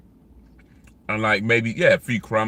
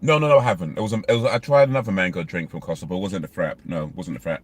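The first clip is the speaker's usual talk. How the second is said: ordinary delivery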